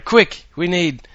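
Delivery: high, loud army command; urgent shouted order. Retold or imitated. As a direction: yell orders at the troops. Army ordering margarine buckets.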